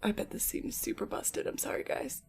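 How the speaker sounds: hushed whisper under the breath. Real, not acted. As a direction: whisper it like testing the mic. Quiet mic testing aside.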